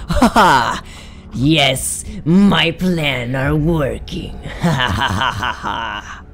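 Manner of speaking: hammed-up slow villain gloat with cackles; evil villain laugh. Gamer playing the killer, gloating in a mock villain voice. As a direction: gloat like a cartoon evil mastermind. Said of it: "I sound like a freak".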